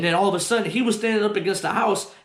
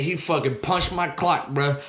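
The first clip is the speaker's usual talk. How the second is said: usual speaking voice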